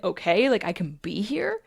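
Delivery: questioning, tentative wonder; voice of the emotion. Acted. Emotion learning it is allowed, tentative question. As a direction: ask permission, voice rising. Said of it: "the emotion's like".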